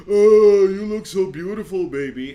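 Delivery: high, loud gushing that eases off; mock simp. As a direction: imitate a fawning admirer, exploding on the first word. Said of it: "'cause guys are simps".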